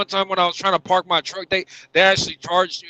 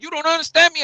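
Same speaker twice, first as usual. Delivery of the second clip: mocking the attendant; 10 semitones higher, faster than usual. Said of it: "And he's like".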